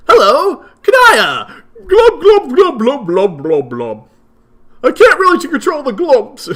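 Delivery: put-on high, loud, bubbly fish girl; excitable cuttlefish voice. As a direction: squeal it out like an overexcited sea creature. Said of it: "Just very excitable".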